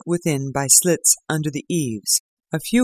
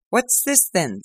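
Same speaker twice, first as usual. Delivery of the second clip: Lestrade's voice; 6 semitones higher than usual. Acted. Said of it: "said Lestrade at last".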